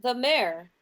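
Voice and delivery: light mimic of the innkeeper; a touch of parroting the woman; imitating the woman. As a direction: copy her words back in her own voice. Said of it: "Candle says in the exact same voice as this woman".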